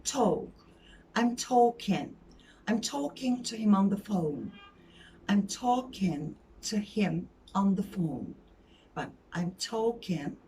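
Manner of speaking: "talking" as "taw-kin", no l; silent l in talking. Shown as a demonstration. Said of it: "L'yi okumuyorsun".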